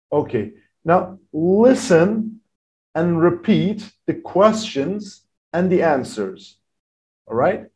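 usual speaking voice

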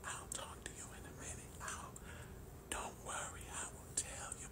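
breathy voiceless whisper, fast at first; hushed mock whisper of a sick wife; imitating a whisper. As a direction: mimic the wife whispering through a sore throat. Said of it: "And so she's whispering".

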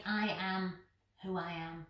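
hushed, low and solemn. Acted. Preacher hushing God's words to the congregation, low and soft.

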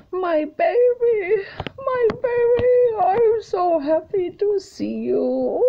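high, slow, crying-happy coo to the horse; squealing with joy at the horse; gushing baby talk. Retold or imitated. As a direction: melt over him like a long-lost baby. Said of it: "and I say".

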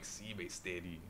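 local Singlish "Sibe steady", very soft. Shown as a demonstration. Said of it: "locals say it that way".

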